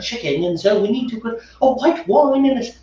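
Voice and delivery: a light, posh-sounding English accent, mocking wine snobs; mock snooty English accent. Mocking wine snobs to his brewing partner in an English accent. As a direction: play a snooty English wine expert lecturing about pairings. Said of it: "and without the English accent".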